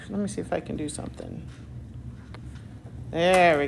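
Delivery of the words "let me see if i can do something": whispering. Real, not acted. Teacher mutters to herself at board.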